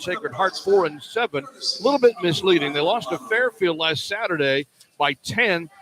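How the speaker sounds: normal talking voice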